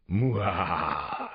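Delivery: hammed-up monster mwah; monster laugh. Father does his monster imitation for the family, hammed-up. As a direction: ham up a silly monster. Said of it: "I did my favorite monster imitation".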